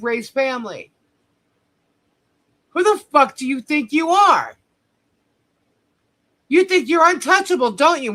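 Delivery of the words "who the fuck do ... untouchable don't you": higher, indignant challenge; angry. Real, not acted. YouTuber confronting a rival she plans to sue, angry challenge. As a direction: confront her head-on.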